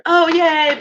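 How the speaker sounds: long crowd yay; applauding crowd. A narrator's reading. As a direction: cheer like an audience. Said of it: "prolonged applause".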